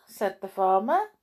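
usual speaking voice

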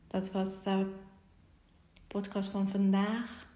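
ordinary talk

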